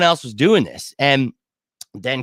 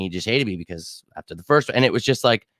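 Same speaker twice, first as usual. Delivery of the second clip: ordinary talk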